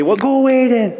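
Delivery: high, mock-petulant dismissal; mock huffy. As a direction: pretend to sulk at the rain. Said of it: "Just kidding".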